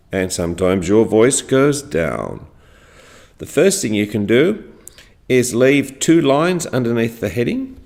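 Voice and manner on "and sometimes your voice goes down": "down" sinks low at the end; falling intonation. Shown as a demonstration. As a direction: drop "down" to a low pitch.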